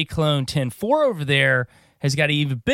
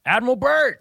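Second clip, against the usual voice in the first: excited; 12 semitones higher than usual. Acted. Hyping the episode topic.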